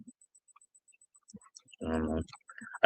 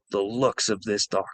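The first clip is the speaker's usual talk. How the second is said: ordinary talk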